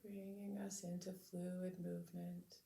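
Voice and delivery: calm, measured meditation voice with long pauses; unhurried, soothing lead-in to stillness; guided meditation. Real, not acted. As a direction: gently guide listeners to settle into the body.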